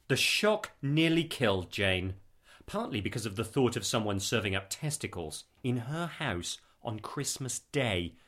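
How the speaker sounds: plain reading voice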